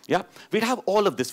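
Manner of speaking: usual speaking voice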